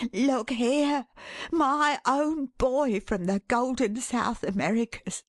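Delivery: lifted, proud father's voice; exulting. A narrator's reading. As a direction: show off your son in triumph. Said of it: "said Caleb exultingly".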